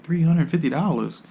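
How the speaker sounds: a mock gasp at three hundred fifty dollars; shocked. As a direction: react to the price in disbelief. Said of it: "I'm like".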